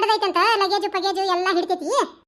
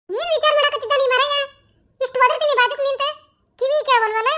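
higher-pitched wifely scolding; exasperated nagging; 4 semitones higher than usual. Acted. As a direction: nag a husband who isn't listening. Wife nagging her inattentive husband. (ಬೇಸತ್ತು ಗದರುವ ಧ್ವನಿ)

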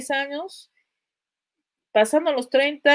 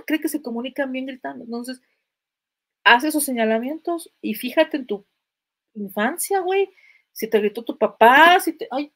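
normal talking voice

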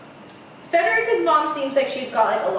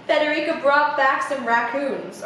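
ordinary delivery

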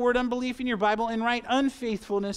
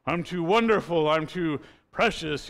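lightly mocking a self-righteous churchgoer, a bit louder at first; mock smug holiness; 3 semitones lower, 4 dB louder than usual. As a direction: tease the proud "I'm too holy" voice. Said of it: "lest you say".

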